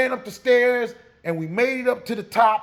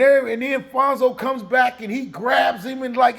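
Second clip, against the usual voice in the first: ordinary delivery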